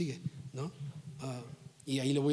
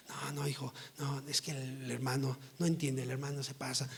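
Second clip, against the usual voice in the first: mock placating parent; 5 dB quieter than usual. Parent siding with the child against the brother, hushed mock soothing. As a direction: imitate a softly excusing parent.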